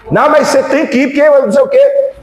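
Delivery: high, pressing voice for the other side; insisting wife. Retold or imitated. Pastor imitating his wife insisting he has to go. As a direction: push her like she won't let it go.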